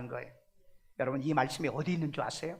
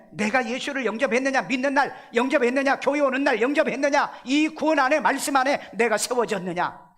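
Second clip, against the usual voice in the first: emphatic preaching; 6 semitones higher, 6 dB louder than usual. Real, not acted. Preacher's rising rhetorical questions.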